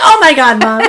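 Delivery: imitating a mortified eleven-year-old; embarrassed son. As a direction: play the boy begging mom to stop. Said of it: "he's like".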